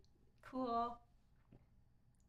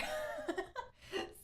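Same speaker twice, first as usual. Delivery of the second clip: high-pitched burst of laughter; high giggle. Real, not acted. Streamer amused at wanting to meet her, high giggle. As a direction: giggle high after your own admission.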